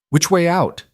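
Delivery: high, urgent demand. A narrator's reading. Alira demands the way out from Thomas, urgently. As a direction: press hard for the way out. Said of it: "she demanded".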